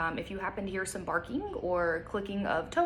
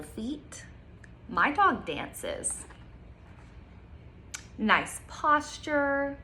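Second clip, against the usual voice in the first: bright storybook reading, slow on the details; reading to children; slower than usual. Acted. Teacher reading a picture book to kids, lively storytime voice. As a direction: read it aloud like storytime.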